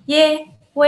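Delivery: "y, w" as high held ye, we; high even syllables for repeating; consonant drill. Shown as a demonstration. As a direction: hold each syllable level and separate. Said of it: "Dan yang terakhir".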